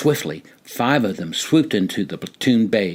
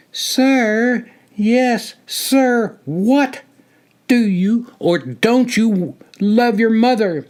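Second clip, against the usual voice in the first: high, loud taunting sing-song; mock his answer, then shout the question; 12 semitones higher, 4 dB louder, slower than usual. A narrator's reading. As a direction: senior mocking the recruit's reply. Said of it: "In a mocking, sing-song manner".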